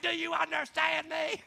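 mock scream from a gleeful instructor; yelling drill instructor. Drill instructor relishing a recruit's panic, mock yelling. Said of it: "the drill instructor's loving it".

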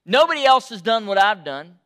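loud, rising angry protest; anger. Acted. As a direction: hurl the protest at God. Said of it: "He starts off with anger".

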